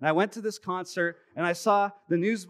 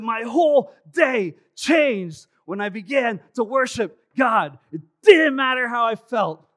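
much higher, louder, slower shouts of worship; shouted praise; 6 semitones higher, 11 dB louder, slower than usual. Retold or imitated. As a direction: cry out to God at full voice. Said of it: "And he said".

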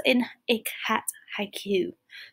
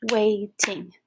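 Waiting split into two beats, Wait-ing; clapping syllables. Shown as a demonstration. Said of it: "I would clap it like so".

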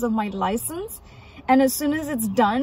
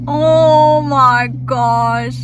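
awed and slow; 8 dB louder, much slower than usual. Real, not acted. Melting over the chicks.

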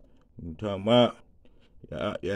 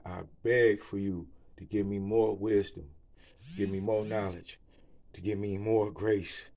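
softer, hushed prayer to the Lord; earnest prayer; 3 semitones lower, 3 dB quieter than usual. Real, not acted. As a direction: pray humbly, almost to yourself. Prays aloud to the Lord.

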